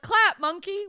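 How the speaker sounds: high, loud mocking command; bossy audience. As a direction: bark it like a crowd. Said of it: "When they're like".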